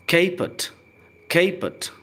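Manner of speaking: "caput" said high as "KAY-put"; model pronunciation. Shown as a demonstration. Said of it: "pronunciation पर ध्यान दें". ("caput" को "केपुट" ऊँचे स्वर में)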